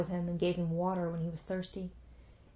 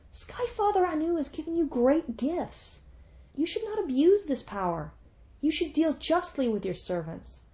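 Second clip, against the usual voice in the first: stern god rebuking; 7 semitones higher than usual. Acted. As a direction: scold her like a disappointed sky god. Divine speaker rebukes Ishtar.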